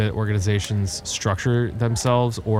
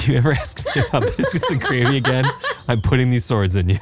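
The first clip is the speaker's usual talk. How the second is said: laughing mock threat; 4 semitones higher, faster than usual. Joking threat while laughing.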